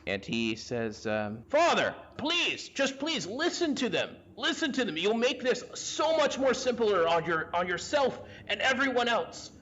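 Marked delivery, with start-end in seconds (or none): 1.5-9.5 high-pitched, pleading son; Papias. Acted. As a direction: beg your father urgently in a raised pitch. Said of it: "you hear the voice of Papias".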